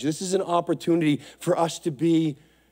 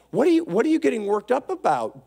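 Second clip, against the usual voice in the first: ordinary delivery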